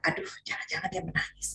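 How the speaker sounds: softly whispered worry; worried whisper. Retold or imitated. Mother whispering her fear backstage. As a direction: whisper it under your breath. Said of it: "saya bilang".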